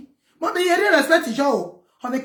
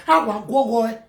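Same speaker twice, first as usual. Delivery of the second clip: ordinary talk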